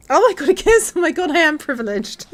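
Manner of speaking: high, loud thrilled exclamation; delighted gush. Real, not acted. Owner getting a kiss from her dog, delighted. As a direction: gush as if greatly honoured.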